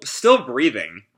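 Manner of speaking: normal talking voice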